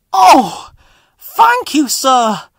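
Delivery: rescued engine thanking his boss; high breathless gratitude; grateful. Acted. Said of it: "said Stepney gratefully".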